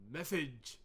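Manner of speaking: sudden call-out. Acted. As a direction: call it out abruptly, loud and high. Sudden outburst mid-reading.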